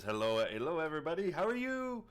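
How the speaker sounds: high-pitched hermit druid greeting; Prattis. Acted. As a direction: pitch climbs into a high, lilting "how are you". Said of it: "I don't remember his accent".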